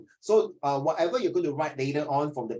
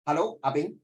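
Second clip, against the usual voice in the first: normal talking voice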